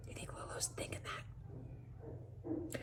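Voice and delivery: breathy hushed aside; whisper. Real, not acted. Teacher asking the class in a whisper. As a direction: lean in and whisper the question. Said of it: "teacher aside whispered".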